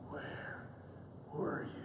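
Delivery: low, whispery growl; mock growl. Wife confronts late husband at the door, growling. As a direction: growl it slowly under your breath. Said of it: "as she growls".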